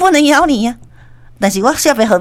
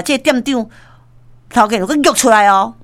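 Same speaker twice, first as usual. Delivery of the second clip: ordinary talk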